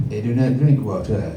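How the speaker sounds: lightly put-on French accent; a hint of a French Jesus; mock French accent, understated. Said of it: "he'll sound something like this".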